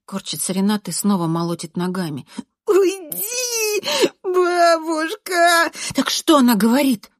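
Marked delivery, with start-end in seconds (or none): 2.7-5.7 high, very drawn-out tearful cry; shrieking wail. A narrator's reading. Writhing boy wailing at grandmother. 5.9-7.1 loud, raised demand; insistent questioning. A narrator's reading. Narrator pressing the boy.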